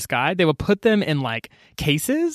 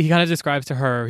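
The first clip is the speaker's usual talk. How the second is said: usual speaking voice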